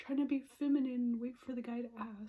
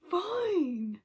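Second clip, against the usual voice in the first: fine, shot out higher and louder; exasperated "fine"; 8 semitones higher, 9 dB louder than usual. Real, not acted. Fed up with saying she's fine, louder exasperated. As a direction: spit the word out, fed up.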